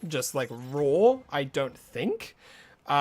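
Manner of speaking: ordinary delivery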